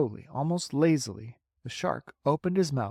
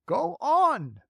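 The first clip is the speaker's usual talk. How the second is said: high frantic call; 14 semitones higher, 4 dB louder than usual. Acted. Crew urging James, high frantic. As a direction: beg him again. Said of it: "they cried frantically".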